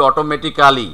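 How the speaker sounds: "অটোমেটিক্যালি" with a full "kaa" before "li"; every syllable of "অটোমেটিক্যালি" sounded; local "অটোমেটিক্যালি". Shown as a demonstration. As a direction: say "অটোমেটিক্যালি" as oto-meti-KAA-li. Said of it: "আমরা বলি".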